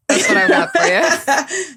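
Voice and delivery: pleased, laughing high. Real, not acted. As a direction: giggle through it. Proud of her Love Island line.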